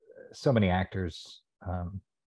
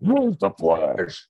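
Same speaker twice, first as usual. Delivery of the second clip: slow, roaring, much higher and louder shark monster; King Shark voice; 7 semitones higher, 7 dB louder, much slower than usual. Shown as a demonstration. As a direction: growl it out like a giant shark. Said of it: "I do King Shark on the Flash T V show".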